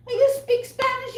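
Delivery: high, loud mocking imitation; exaggerated high-pitched mock asking; mock customer question. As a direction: mimic a pestering customer in a high voice. Mimics customer asking for Spanish.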